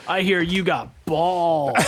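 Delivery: usual speaking voice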